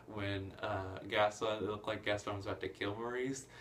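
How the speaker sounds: normal talking voice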